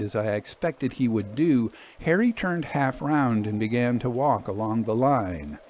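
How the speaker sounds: ordinary delivery, nothing marked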